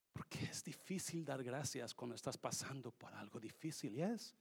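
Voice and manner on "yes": ordinary talk, nothing marked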